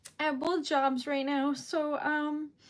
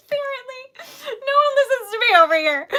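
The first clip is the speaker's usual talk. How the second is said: tearful rant; 9 semitones higher, 11 dB louder than usual. Real, not acted. Joking complaint while crying.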